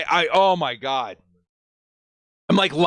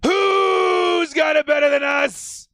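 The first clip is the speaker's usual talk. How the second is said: triumphant shout; 9 semitones higher, slower than usual. Real, not acted. Fan yelling the team motto at his viewers, triumphant shout. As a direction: bellow it like a team chant. Celebrating draft pick on stream.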